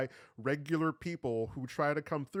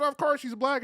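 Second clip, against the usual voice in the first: mock quote; 11 semitones higher, 5 dB louder than usual.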